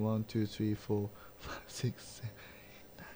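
very soft count fading into a whisper; counting under breath. Real, not acted. Host tallying the league's teams to himself, under his breath. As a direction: count to yourself, trailing into barely audible whispering.

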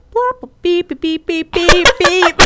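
high-pitched rapid mock beeps; imitated censor beep. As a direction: beep like a censor button over swearing. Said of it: "walked around with a bleep button".

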